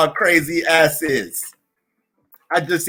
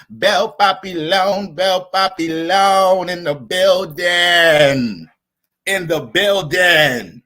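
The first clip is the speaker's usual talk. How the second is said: very slow stretched announcer call; drawn-out shout-out; 2 semitones lower, much slower than usual. Real, not acted. Streamer announcing a viewer, slow MC call. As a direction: stretch the name out like an MC.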